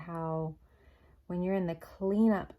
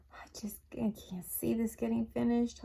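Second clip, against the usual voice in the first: breathless whisper of defeat; 5 semitones higher than usual. Retold or imitated. Worn-out wife whispering to husband while clearing trees. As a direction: whisper it like you're about to break. Exhausted answer to her husband.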